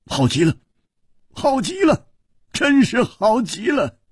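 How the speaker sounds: rising to high jubilant exclamations, then laughter; delighted laughing. A narrator's reading. King gets his escort, delighted and ending in laughter.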